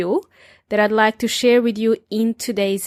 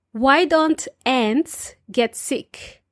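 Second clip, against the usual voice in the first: telling a joke. Shown as a demonstration. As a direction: deliver the punchline deadpan. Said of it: "Dad joke number one".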